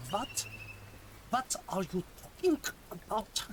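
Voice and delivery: clipped, hammed-up German accent; Colonel Klink. Shown as a demonstration. Streamer demonstrating clipped syllables for chat, mock German officer accent. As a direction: clip it like a sitcom German officer. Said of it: "It's a little bit Colonel Klink".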